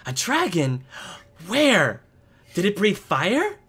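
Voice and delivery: put-on high, loud, thrilled child questions; excited little boy. Boy asking the visitor about a dragon, excited child voice. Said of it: "Jory, uh, turns to you".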